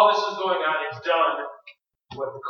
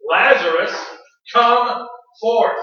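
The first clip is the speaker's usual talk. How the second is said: commanding call; 3 semitones higher, 7 dB louder, slower than usual. Acted. Preacher as Jesus raising Lazarus, commanding call. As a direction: summon a dead man from his tomb.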